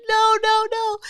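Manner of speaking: rapid-fire high, loud mock screams; kids' panicked cries. Kids scattering from the creature, panicked cry.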